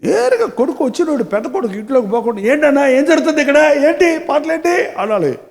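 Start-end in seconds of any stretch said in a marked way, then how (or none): 2.4-4.9 curious elder son. Acted. Elder son asks servant about music.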